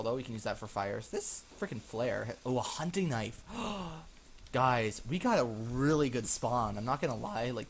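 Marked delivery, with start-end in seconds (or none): 3.4-4.5 soft, long intake of breath; amazed drawn-in breath; delighted gasp. Real, not acted. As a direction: gasp at a great find. Finds a hunting knife.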